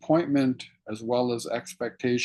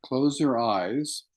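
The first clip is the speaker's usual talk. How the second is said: guided meditation. Acted. Teacher guiding students to feel their sit bones, calm meditation voice. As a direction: ease listeners into their seated bodies, unhurried.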